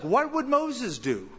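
slow, lifted musing; wondering question. Retold or imitated. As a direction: muse over it like a puzzled student. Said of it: "ask themselves questions like".

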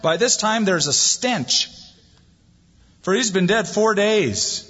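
slow it down as a reluctant objection; Martha protesting. Retold or imitated. Martha warning Jesus about the stench, lightly voiced. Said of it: "Martha ... said to him".

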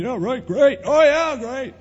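mock the distracted nodder, sing-song; fake agreement. A half-listening friend nodding along, hollow mock enthusiasm. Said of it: "They're nodding their head".

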